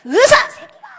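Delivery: high, loud retold curse; furious outburst. Fuming parent cursing smoking doctors, high loud outburst. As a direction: burst out in re-enacted fury. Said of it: "너무 열받아갖고".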